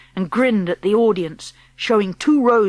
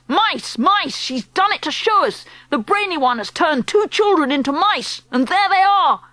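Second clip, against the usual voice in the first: high panicked shrieks; crying out; 8 semitones higher than usual. Acted. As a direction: shriek and point in alarm. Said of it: "crying out".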